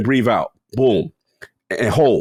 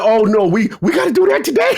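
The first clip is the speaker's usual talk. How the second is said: high-pitched alarm tipping into laughter; comic dismay; 7 semitones higher than usual. Retold or imitated. Patient told of lung risk, comically dismayed. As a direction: climb into a panicky high pitch. Retold dismay at risky procedure.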